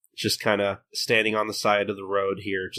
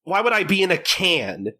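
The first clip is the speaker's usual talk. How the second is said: higher, slowed, incredulous scolding; annoyed Gintoki; 7 semitones higher than usual. Retold or imitated. Gintoki scolding Katsura from behind the wall, higher incredulous tone. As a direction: scold him like he's an idiot.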